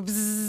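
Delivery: long drawn-out buzz; buzzing hornet noise. Pretend. Joking about her hornet master, drawn-out insect buzz.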